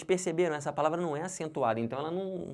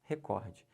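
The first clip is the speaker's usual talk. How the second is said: "recorde" as reCORde, softer; stress on COR; 4 semitones lower, 5 dB quieter than usual. Shown as a demonstration. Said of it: "O certo é". ("recorde" com a tônica no COR)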